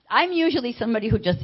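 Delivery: ordinary delivery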